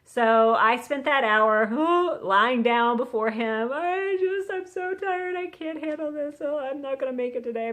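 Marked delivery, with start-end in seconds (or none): 3.7-7.7 hammed-up self-pitying complaint; whiny exhausted prayer. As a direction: whine it out, worn out and helpless. Acting out her exhausted morning prayer.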